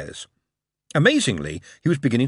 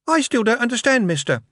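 put-on puzzled child; 14 semitones higher, 4 dB louder than usual. A narrator's reading. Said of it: "still puzzled".

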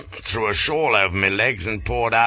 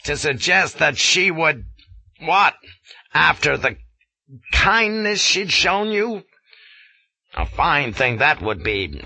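louder, higher indignant lecture; 8 semitones higher, 4 dB louder than usual. A narrator's reading. Colonel defending the Maharani to Flashman, indignant. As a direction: defend her honour, offended. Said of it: "He was in a fine indignation".

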